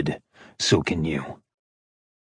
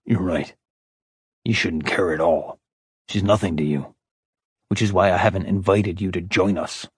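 softer and lower, giving ground; low, conceding Ash; 3 semitones lower, 3 dB quieter than usual. A narrator's reading. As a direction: placate him quietly, hands up. Ash held his hands up.

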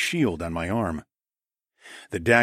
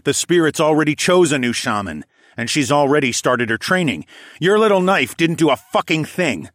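loud, raised triumphant taunt; 7 semitones higher, 6 dB louder than usual. A narrator's reading. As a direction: rub the failure in, forcefully. Hero taunts the pinned killer.

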